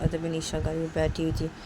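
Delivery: ordinary talk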